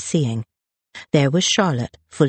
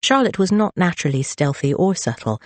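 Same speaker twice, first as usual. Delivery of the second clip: ordinary delivery, nothing marked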